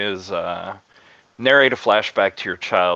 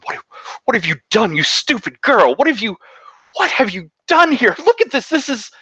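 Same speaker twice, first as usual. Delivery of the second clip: furious, much higher and louder; yelling mother; 4 dB louder than usual. Acted. Mother bursts in yelling at the servant girl over the haircut. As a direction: shriek at the girl in outrage. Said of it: "starts yelling".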